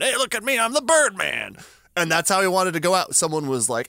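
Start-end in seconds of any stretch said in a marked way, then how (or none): 0.0-1.6 a hint of a proud Birdman showing off, higher; lightly voiced Birdman calling out; goofy Birdman. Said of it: "he could have been a Birdman".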